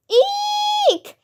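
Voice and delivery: a tiny high-pitched shriek of fright; cartoon scream from the whole group; high squeal. A narrator's reading. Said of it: "we all screamed".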